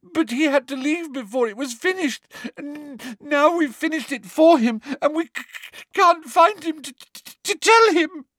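very high, loud stuttering plea; girl faking distress to her teacher; stammering tearful girl. A narrator's reading. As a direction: stammer through pretend tears. Hermione voiced.

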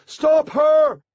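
loud, very high cry; desperate call to intervene; urgent shouted order. A narrator's reading. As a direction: shout the command in alarm. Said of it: "he called".

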